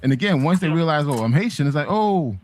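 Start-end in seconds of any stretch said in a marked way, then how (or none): none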